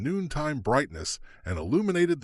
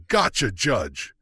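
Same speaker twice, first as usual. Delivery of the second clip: slow, louder call; shout; 4 dB louder, slower than usual. Acted. The marshal answers the judge, slow louder call. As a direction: call it out, on your way. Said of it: "Tony shouted".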